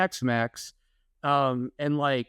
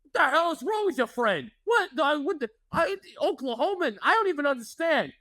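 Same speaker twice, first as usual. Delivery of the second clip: high, mock-exasperated old man; father-in-law's grumble; 11 semitones higher than usual. Father-in-law complaining to his wife about the friend, high mock outrage. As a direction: sputter in disbelief at the friend. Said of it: "And he'd go".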